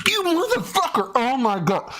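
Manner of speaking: high-pitched furious yell; furious shout. Real, not acted. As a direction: explode at him in rage. Furious outburst at guest.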